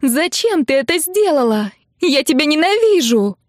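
high-pitched angry accusation; furious yelling. A narrator's reading. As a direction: hurl it at them in outrage. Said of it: "заорала Нелли".

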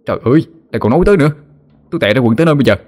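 high, fast, frightened outburst; panicked wail. A narrator's reading. A scared boy yelling at Hoa to stop talking about ghosts, panicked and fast. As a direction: blurt it out in a rush of terror. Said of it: "thì Nam lại gào lên".

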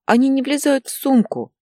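put-on high, loud little-boy complaint; breathless, upset child. A narrator's reading. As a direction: complain like a boy gasping with distress. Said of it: "задохнулся Илья". (отчаянная детская жалоба)

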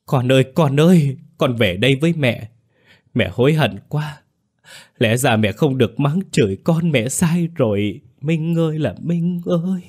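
high, loud anguished wail, slowing near the end; keen for the dead child like a madwoman; mother wails remorsefully at her son's funeral; frantic wailing. A narrator's reading. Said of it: "la hét như một kẻ điên".

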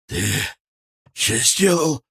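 strained croak rising high on the curse; hoarse wheeze. A narrator's reading. Бычок, crawling, curses the man who hurt him, hoarse wheeze. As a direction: force it out in broken, hoarse pieces. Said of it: "прохрипел Бычок".

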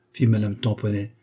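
plain reading voice

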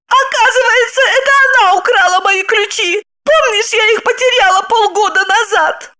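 high-pitched, agitated accuser; excited woman character. A narrator's reading. (возбуждённо, высоким женским голосом)

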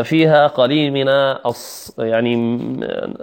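ordinary delivery

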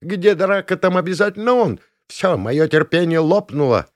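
high-pitched indignant cry; outraged warden's high holler; shouting warden. A narrator's reading. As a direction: call everyone to witness, pitched high. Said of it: "кричала она".

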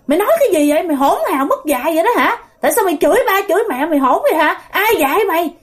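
high-pitched mock telling-off; retold scolding. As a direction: imitate a father rebuking his child, rapid questions. Father scolds his cursing daughter. (giả giọng cha mắng con)

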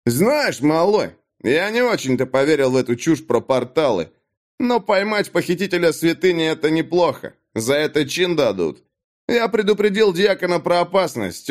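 Dag, smug bully. A narrator's reading. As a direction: sneer it at the kid like a thug. Dag's voice, tough guard. (Даг, самодовольный громила, насмешливо)

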